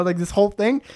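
normal talking voice

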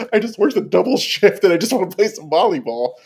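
high-pitched put-upon voice, imitating; pleading tired player. Voicing the player he berated.

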